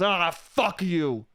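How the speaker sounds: slow, strained growl into a curse; hammed-up hangry outburst; angry cursing. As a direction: build a groan into the swear. Hungry man curses at ice cream.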